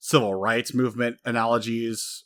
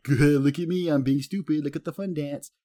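higher, mock-silly show-off voice; goofy clowning brothers; 5 semitones higher than usual. Big brothers clowning to comfort their sister, goofy. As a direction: play the fool in a raised voice.

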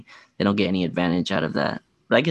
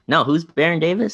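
usual speaking voice

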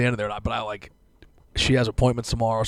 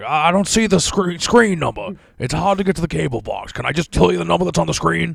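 higher, louder imitation of a flustered dad; exasperated father on phone; 7 semitones higher, 5 dB louder than usual. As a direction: play up a dad struggling with customer service. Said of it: "he's just, like, being funny".

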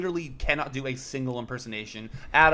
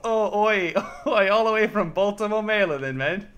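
higher, louder mock Cockney British accent; hammed-up British accent; 8 semitones higher, 5 dB louder than usual. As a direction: overdo a bad British accent with "oi". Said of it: "doing a British accent to represent Baltimore".

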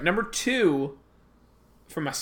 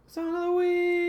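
put-on voice; 14 semitones higher, slower than usual. High put-on voice.

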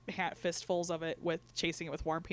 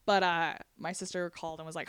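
ordinary talk

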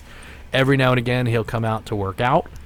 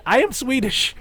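high mock Swedish, AM stressed; hammed-up fake Swedish accent leaning on AM; bad Swedish accent; 13 semitones higher than usual. A fake Swede telling the crowd in a bad Swedish accent. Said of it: "his accent was so bad".

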